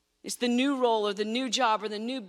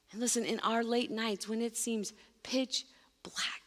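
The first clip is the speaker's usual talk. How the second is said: ordinary delivery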